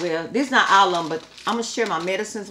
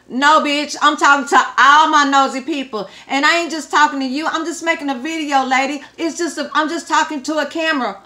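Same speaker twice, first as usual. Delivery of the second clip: higher, louder, sassy retort; indignant comeback; 7 semitones higher, 5 dB louder than usual. Real, not acted. Vlogger snapping back at a hateful commenter, indignant and loud. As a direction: tell her off for real.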